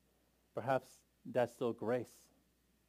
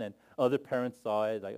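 normal talking voice

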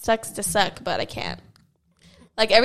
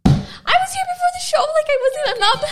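much higher whiny protest; whiny defensive voice. Retold or imitated. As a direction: whine it like a defensive teen. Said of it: "and I'm like".

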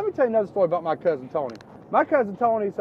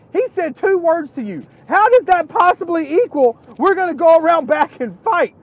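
high, loud, panicked protest; alarmed disbelief; 14 semitones higher, 14 dB louder than usual. Retold or imitated. Storyteller recalls shock at his cousin's plan, high and panicked. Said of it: "And I was like".

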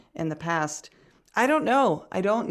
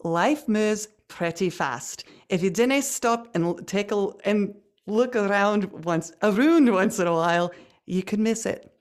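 halting, careful attempt at Scottish; Scottish accent. Shown as a demonstration. Podcast host attempts a film quote on request, tentative Scottish accent. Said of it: "how's your accent coming along? The Scottish one".

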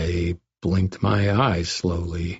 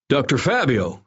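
higher-pitched alarmed call; crying out; 7 semitones higher than usual. A narrator's reading. Guard Steve bursts in on the doctor, crying out. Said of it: "Steve cried".

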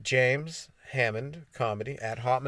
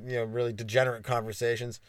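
usual speaking voice

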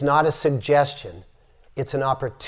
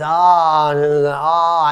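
loud open ah, head back; open-throat ah; 5 dB louder, much slower than usual. Retold or imitated. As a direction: let it burst out of the open throat. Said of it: "and we're doing this".

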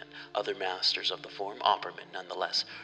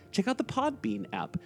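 high squeaky voice; 11 semitones higher than usual. Acted. Mock endorsement of Podbean app.

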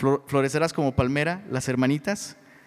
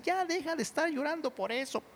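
put-on exhorting older women; 6 semitones higher, 4 dB quieter than usual. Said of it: "se enfocan así".

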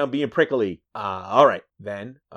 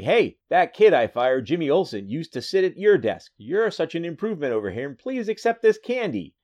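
lightly voiced smitten boss, higher; 7 semitones higher, 4 dB louder than usual. Boss offering candy to the new girl reporter, lightly acted. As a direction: gush a little, lifting high at the end.

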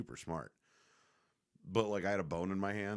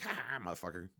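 taunting the dog; 8 semitones higher, 3 dB louder than usual. Retold or imitated. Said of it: "And then it's like".

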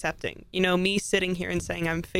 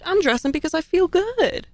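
defiant; 11 semitones higher, 5 dB louder than usual. Retold or imitated. Defiant retort in retold exchange.

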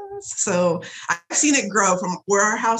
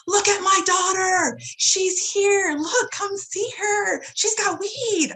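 very high, drawn-out mock yelling; imitated proud screaming; 12 semitones higher, much slower than usual. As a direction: holler to the whole street, far higher.

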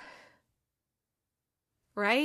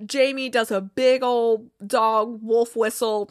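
ordinary talk, nothing marked

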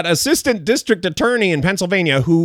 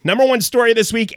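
ordinary talk

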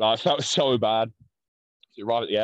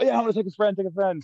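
put-on eager teen self; 10 semitones higher than usual. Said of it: "And so I was like".

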